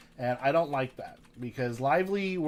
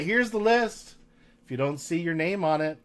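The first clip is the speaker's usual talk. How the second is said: ordinary talk, nothing marked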